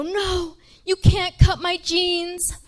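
high, drawn-out comic wail; hammed-up indignant plea, loud and slow; horrified protest. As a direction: protest as if the jeans matter most. Retold protest at jeans being cut.

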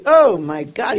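loud disgusted outburst; angry exclamation. Retold or imitated. Contractor reacting to the shack, loud angry outburst. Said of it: "He was angry".

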